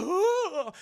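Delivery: high, loud startled "oh"; hammed-up shriek of alarm; mock fright scream. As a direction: yelp at a sudden monster. Retold fright at seeing creature.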